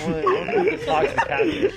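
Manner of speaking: high squeaky goofy voice, fast and laughing; squeaky laughing mock voice; mock silly voice. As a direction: play it as a giddy kid who wants to chat with a fish. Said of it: "I was like".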